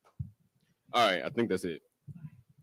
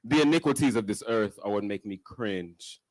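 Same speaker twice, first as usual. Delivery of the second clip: spoken-word poem; 3 semitones higher than usual. Acted. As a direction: recite it as a spoken-word poem, swinging in pitch. Recites own first poem at open mic.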